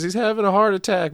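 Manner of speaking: high, loud mock panic; high-pitched, hammed-up alarm; Pearl's alarmed cry. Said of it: "Pearl says".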